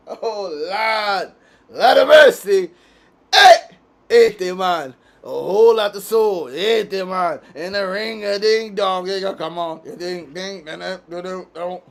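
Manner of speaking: loud, exclaiming fake Jamaican accent; playful Jamaican put-on, big shouts easing into nonsense; mock Jamaican accent. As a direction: holler in a hammed-up Jamaican voice. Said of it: "I don't got the accent".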